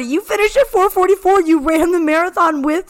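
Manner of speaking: high, loud excited announcement; excited reveal. Real, not acted. Co-host thrilled to reveal her finish time. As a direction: reveal it with a burst of delight.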